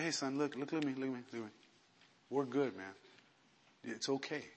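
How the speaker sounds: soft, low, gentle reassurance; calm him down tenderly, almost under the breath; retold compassion. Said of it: "gave me so much compassion for him".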